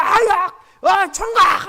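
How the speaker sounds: high, loud, bossy shouting; mock angry yell. The boss yelling at the student, angry and domineering. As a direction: bark orders at the helper. Retelling an angry boss's outburst.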